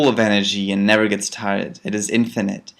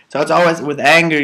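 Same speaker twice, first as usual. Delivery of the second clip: ordinary talk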